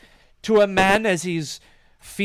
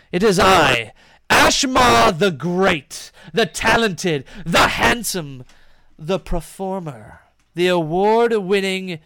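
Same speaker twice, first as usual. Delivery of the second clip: loud, slow grandiose self-introduction; grand boast; 5 dB louder than usual. Acted. Ashmar the Great boasting to the strangers, loud. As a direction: announce yourself like a star.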